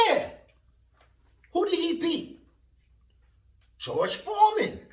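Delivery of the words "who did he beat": normal talking voice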